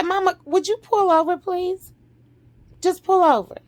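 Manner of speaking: high, pressed request to pull over; urgent plea. Retold or imitated. Daughter asks mother to pull over, urgent plea.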